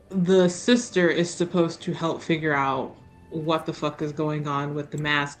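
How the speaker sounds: ordinary delivery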